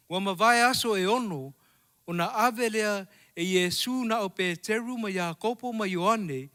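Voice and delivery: ordinary delivery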